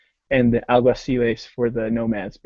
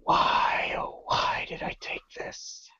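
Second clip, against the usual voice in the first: hushed regretful whisper; 12 dB quieter, slower than usual. Retold or imitated. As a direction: whisper it to yourself in despair. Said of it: "I'm staring at this Berka going".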